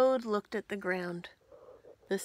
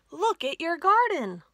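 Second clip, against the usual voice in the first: higher and louder, thrilled; excited; 8 semitones higher, 10 dB louder than usual. Acted. Frog showing Toad his sprouted garden, excited. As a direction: show him the garden with delight.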